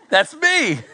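squeaky mock triumph; mock proud delight. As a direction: pop up in comic self-congratulation. Proud inner reaction to critique.

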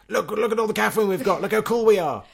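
high, bragging sales voice; boastful tin voice. Retold or imitated. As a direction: voice the tin showing off. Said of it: "the tin is very keen to make that clear".

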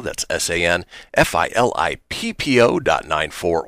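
ordinary talk

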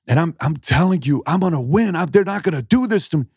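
high, impassioned outpouring, pitch climbing; worked-up retelling. Retold or imitated. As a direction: imitate an upset young man building to a defiant vow. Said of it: "he goes off and he's crying".

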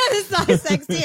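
laughing. Real, not acted. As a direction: echo it laughing. Laughing echo.

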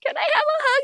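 small, very high pleading voice; acted-out child asking mom for comfort; tender plea. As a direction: ask needily, high-pitched.

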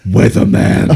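low, breathy dramatic announcement; deep announcer voice. Acted. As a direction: intone it like a trailer voice. Announcing a character name.

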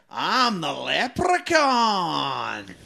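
drawn-out, theatrical leprechaun cry; horror movie leprechaun. Shown as a demonstration. Host showing contestant her buzzer line, theatrical horror leprechaun. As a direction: stretch it slowly into a long horror-movie howl. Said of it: "a famous horror movie line".

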